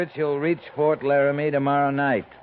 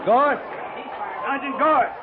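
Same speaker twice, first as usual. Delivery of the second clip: high shout for the sergeant; calling out; 13 semitones higher than usual. Acted. Wounded captain calling for his sergeant, shouting. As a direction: shout his name over the noise.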